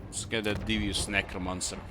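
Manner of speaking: ordinary talk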